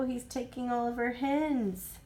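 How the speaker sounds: usual speaking voice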